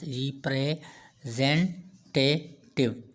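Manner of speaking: रिप्रेजेंटेटिव very slowly as ree-pre, zen, te, tiv, with pauses; teacher spelling out रिप्रेजेंटेटिव by sound, piece by piece; syllable by syllable. Shown as a demonstration. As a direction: break रिप्रेजेंटेटिव into separate, unhurried beats. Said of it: "many movements के साथ".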